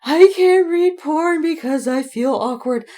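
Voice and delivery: hammed-up embarrassed whine; mock awkward self. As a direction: squirm like an embarrassed prude. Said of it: "get over my thing where it's like".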